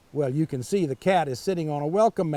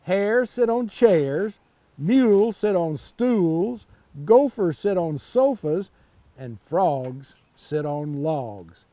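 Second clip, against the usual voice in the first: slow rhyme recital; slower than usual. Shown as a demonstration. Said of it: "here's the answer".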